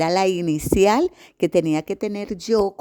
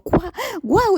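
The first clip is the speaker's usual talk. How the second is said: amazed; 13 semitones higher than usual. Retold or imitated. Amazed reaction to a tip.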